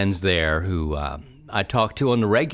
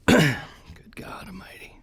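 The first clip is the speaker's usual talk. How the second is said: slow, higher voiceless whisper; whispered exclamation; 9 semitones higher, slower than usual. Pretend. Scandalized Baltimore hon whispering, slow and voiceless.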